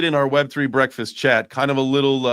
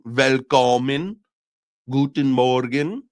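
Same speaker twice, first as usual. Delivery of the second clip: slow, drawn-out amateur German; hammed-up beginner's German; poor German attempt; 3 dB louder, slower than usual. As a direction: have a clumsy go at German, stretching each word. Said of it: "That's my poor attempt at, uh, the German".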